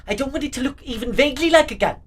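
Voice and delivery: high, fast, loud protest; worked-up mock rant; mock exasperation. Mock rant about redesigned screwdriver.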